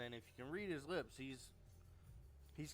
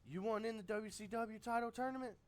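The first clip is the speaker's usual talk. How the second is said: high, loud cocky boss voice; Ric Flair impression; 8 semitones higher, 4 dB louder than usual. Flair tells Hall, Nash and Steiner who's in, loud and high. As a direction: lord it over them as the president. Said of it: "He says".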